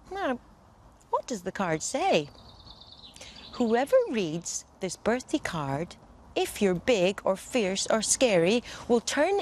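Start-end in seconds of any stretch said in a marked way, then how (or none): none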